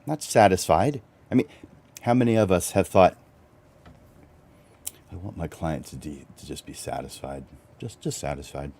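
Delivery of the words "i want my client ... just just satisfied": much softer, deflated mock quote; mock deflated wish. Mocking photographers who settle for satisfied, softly deflated. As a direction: sound underwhelmed.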